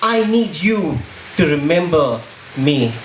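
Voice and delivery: spoken-word poem. Acted. Poet accusing those who want blood, impassioned spoken word. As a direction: perform it as a driving, emphatic protest poem.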